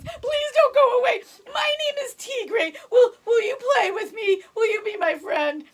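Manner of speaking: high, loud tearful cub voice; baby tiger pleading. Acted. Baby tiger begging the goat to stay, high and tearful. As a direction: beg like a lonely little cub. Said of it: "and the tiger said".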